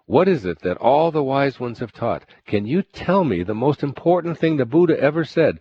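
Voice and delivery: high, insistent official; eager governor. Acted. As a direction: press him for an answer. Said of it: "The governor continued".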